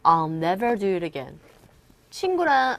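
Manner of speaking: ordinary talk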